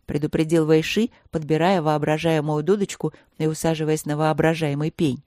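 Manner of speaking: ordinary delivery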